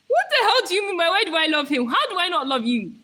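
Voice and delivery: loud, high, fast indignant question; mock outrage. Streamer challenges viewer asking why she loves him, loud indignation. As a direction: fire it back, scandalised.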